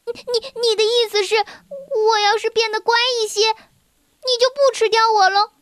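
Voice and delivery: put-on a frightened girl trying a deal; stammering scared girl. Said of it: "阻止他说".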